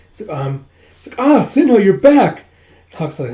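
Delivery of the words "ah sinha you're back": high, loud happy cry of his name; overjoyed to see him back; joyful greeting. Acted. Dragon greets returning Sinha with a joyful cry.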